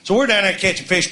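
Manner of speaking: usual speaking voice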